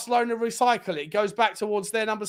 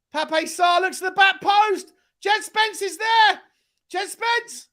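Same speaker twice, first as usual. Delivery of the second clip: high, urgent running commentary; excited play-by-play; 9 semitones higher than usual. Real, not acted. Fan calling Spurs' attack, excited play-by-play. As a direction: follow the ball on a high, sustained pitch.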